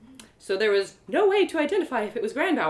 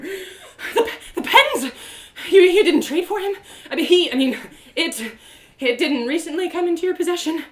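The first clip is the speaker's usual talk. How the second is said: high, loud, stammering questions; frantic, pitched-up disbelief; flustered panic; 7 semitones higher, 8 dB louder than usual. Acted. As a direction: fumble for words in alarm. Panicked questioning about the goat.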